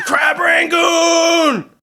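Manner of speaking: long, high, loud drawn-out holler; mimicked crowd shout, high and stretched; imitating drunken yelling. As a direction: yell it like a drunk heckler, stretching the vowels. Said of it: "they all just started yelling".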